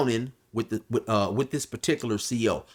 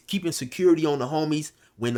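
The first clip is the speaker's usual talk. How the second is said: normal talking voice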